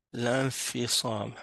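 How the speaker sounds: slow "l'infisam", heard as one word lanfisam; la fused into noun. Shown as a demonstration. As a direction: model "l'infisam" slowly with no break after L. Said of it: "c'est comme si, en fait, c'était".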